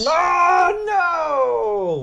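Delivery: high, loud drawn-out wail; big, loud cry of dismay; dismayed shout. Real, not acted. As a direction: howl in defeat. Loses on final question.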